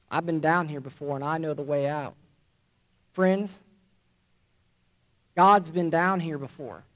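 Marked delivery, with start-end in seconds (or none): 0.1-2.1 lower, softer and fading; softer repeat. Acted. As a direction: repeat it almost to himself. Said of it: "he responded softly".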